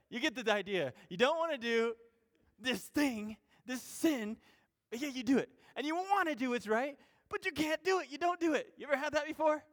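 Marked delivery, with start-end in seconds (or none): 5.8-9.6 much higher, louder pleading; climbing fervent appeal; rising intensity. Acted. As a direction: push it up with urgency. Preacher building intensity.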